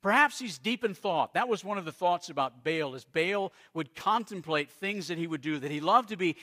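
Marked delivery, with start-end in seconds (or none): 0.0-1.3 loud, higher mocking taunt; scoffing. Elijah taunts Baal's prophets, loud scoffing. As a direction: jeer at them like Elijah. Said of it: "he scoffed".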